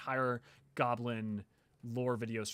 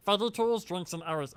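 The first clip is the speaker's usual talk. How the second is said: broken-English goblin, a touch higher; chirpy little goblin scholar; 5 semitones higher, 4 dB louder than usual. Acted. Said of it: "excited to do my goblin voice".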